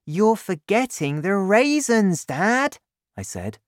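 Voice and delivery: put-on excited boy laying out his plan; lightly voiced boy, thrilled with his idea; young Danny.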